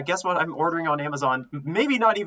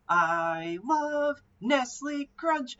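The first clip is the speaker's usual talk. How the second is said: much higher, very slow put-on voice; viral video guy; 10 semitones higher, 3 dB quieter, much slower than usual. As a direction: drag it out like the viral clip. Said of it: "that TikTok video that went viral".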